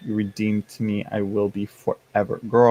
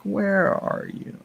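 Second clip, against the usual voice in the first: searching inner voice; 9 semitones higher, 3 dB quieter, slower than usual. Retold or imitated. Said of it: "I'm like".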